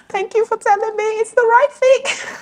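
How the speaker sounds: very high, squeaky put-on thanks; hammed-up high voice, as if through tears; mock tearful thanks. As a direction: thank them in a tiny, high-pitched wail. Acted tearful thanks after hard truth.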